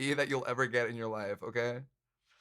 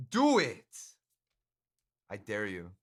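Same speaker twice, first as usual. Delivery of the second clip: egging on; 6 semitones lower than usual. Real, not acted. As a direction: push them to go for it. Daring artist in chat.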